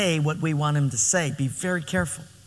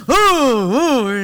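loud dismayed ooh; 5 semitones higher, 7 dB louder than usual. Retold or imitated. Husband angry at wife's gift.

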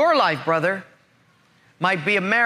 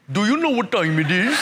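imitated East Indian accent, rising question. Phrase ringing in traveler's head, East Indian accent. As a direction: echo the gate man's East Indian accent. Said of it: "morphed from an East Indian accent".

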